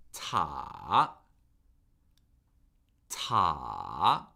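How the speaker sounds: "ta" dipping low then rising; third tone. Shown as a demonstration. Teacher modelling tones for repeating, "ta" falling then rising. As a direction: start "ta" low, fall, then swing back up. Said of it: "it falls and then rises".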